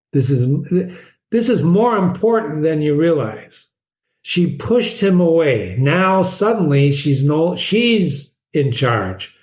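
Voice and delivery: ordinary delivery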